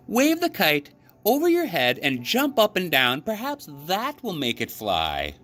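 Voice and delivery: excited frog full of hope; bright, chirpy storybook Frog; Frog's voice. A narrator's reading.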